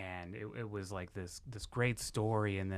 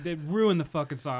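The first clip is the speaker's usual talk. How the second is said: louder, much higher indignant cry; outraged outburst; 7 semitones higher, 9 dB louder than usual. Real, not acted. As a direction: vent at the ruined song. Outraged at the fake story.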